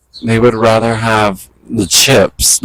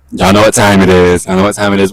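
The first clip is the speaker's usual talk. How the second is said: normal talking voice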